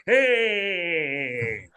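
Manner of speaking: long drawn-out, falling greeting; creepy barfly. Acted. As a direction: greet the light like a creepy bar regular. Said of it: "one of the creepy creatures of the bar".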